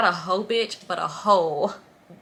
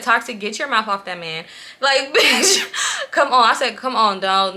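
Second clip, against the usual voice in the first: louder, exasperated telling-off with laughter; annoyed scolding; 3 semitones higher, 5 dB louder than usual. Retold or imitated. Scolding the friend in the front seat, annoyed. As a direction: scold her, half laughing. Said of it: "I was annoyed".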